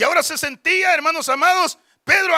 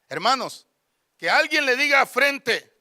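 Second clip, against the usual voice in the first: usual speaking voice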